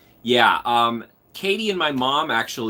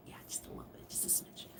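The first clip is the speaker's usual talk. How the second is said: whisper; 23 dB quieter than usual. Real, not acted. Softly teasing restraint.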